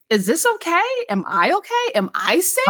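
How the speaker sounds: high, worried self-questioning; anxious inner voice. Retold or imitated. Anxious inner voice, Southern encounter.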